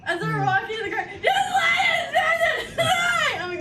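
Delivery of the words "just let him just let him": much higher, louder and very slow wailing plea; crying child plea. Retold or imitated. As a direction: wail it like an overtired toddler. Tired child crying in retold scene.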